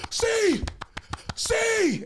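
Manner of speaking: high, loud shouts to wake someone; loud wake-up yelling. Retold or imitated. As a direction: shout to rouse a sleeping friend. Homie calling his name.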